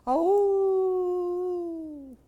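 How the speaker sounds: a high, loud, sudden Oh; the boy jumps at a noise in the dark; startled child's cry; startled gasp. Acted. Grandson startled by a sound.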